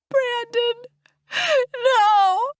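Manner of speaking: high wail building to a loud scream; high, distraught shriek; anguished scream. A narrator's reading. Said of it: "another murderous scream echoes".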